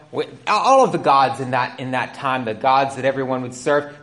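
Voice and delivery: ordinary talk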